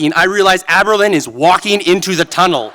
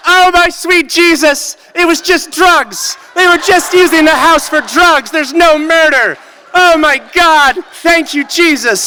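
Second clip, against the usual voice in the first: relieved shouting; 10 semitones higher, 4 dB louder than usual. Retold or imitated. Narrator to everyone upstairs, ecstatic high relieved shouting. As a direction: shout thanks to Jesus in giddy relief, pitched way up. Relief after learning it's drugs.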